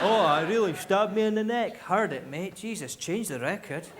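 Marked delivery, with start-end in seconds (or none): 0.0-1.8 mock thug. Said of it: "As if he goes".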